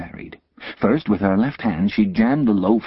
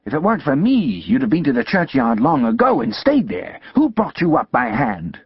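higher, loud shrewish scolding; scolding sister; 6 semitones higher, 3 dB louder than usual. Acted. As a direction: berate the boy like a furious older sister.

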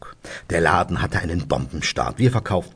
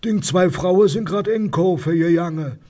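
high-pitched Rhineland dialect neighbour; nosy neighbour; 11 semitones higher, 3 dB louder than usual. Acted. Neighbour passes on news from the street, high dialect voice. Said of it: "kriege ich die Information von meinem Nachbarn".

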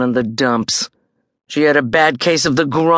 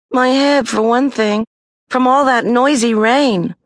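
Judy whining; 10 semitones higher, 4 dB louder than usual. A narrator's reading. Judy faking illness to Mom.

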